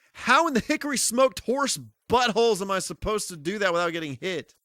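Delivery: high, indignant rant; exasperated outburst. Real, not acted. Frustrated at hard game section.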